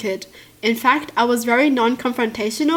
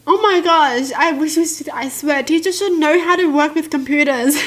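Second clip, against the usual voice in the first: louder, higher exasperated rant; exasperated outburst; 5 semitones higher, 4 dB louder than usual. Real, not acted. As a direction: burst out in disbelief. Reacting to teacher story.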